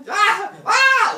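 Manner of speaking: loud high alarmed screams; crowd screaming. Retold or imitated. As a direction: scream and point in horror.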